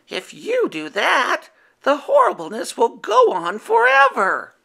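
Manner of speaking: firm high werewolf; firmly. A narrator's reading. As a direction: set him straight gently but firmly. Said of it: "replied Howler firmly".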